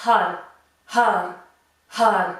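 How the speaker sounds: "Har" with a breathy H and a rolled R; "Har" pushed out with rolled R. Shown as a demonstration. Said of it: "Prononcez bien le H et essayez de rouler les R".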